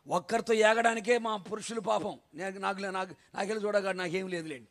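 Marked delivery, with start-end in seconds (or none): none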